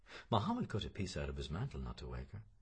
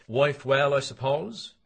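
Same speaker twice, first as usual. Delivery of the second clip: McCoy's voice suddenly higher and louder; changed voice; 10 semitones higher, 15 dB louder, slower than usual. A narrator's reading. McCoy asking Bloom about his wife, voice changing. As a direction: shift gear into a louder, higher, pointed question. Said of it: "McCoy's changed voice said".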